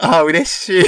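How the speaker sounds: happy laughing exclaim; delighted. Real, not acted. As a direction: burst out with joy. Delighted by the greeting.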